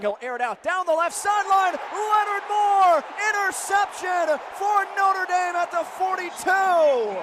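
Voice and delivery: loud, high rising call of the interception; excited play-by-play. Acted. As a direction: sell the big defensive play.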